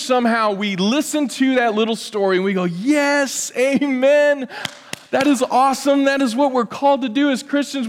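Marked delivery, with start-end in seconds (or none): 2.6-7.9 higher, gushing believer's cheer; mock enthusiasm. Pastor parodying believers cheering the mouse story, gushing and higher. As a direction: parody churchgoers eagerly applauding.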